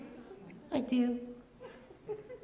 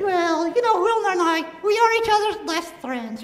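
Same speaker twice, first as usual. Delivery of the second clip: squeaky ventriloquist dummy; 7 semitones higher than usual. Acted. Dummy answers host.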